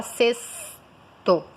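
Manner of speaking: "sexto" as SES-to, slowly split; slow "sexto" with a long S; standard S pronunciation. Shown as a demonstration. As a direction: stretch the S in "sexto". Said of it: "a regra geral diz que você fala".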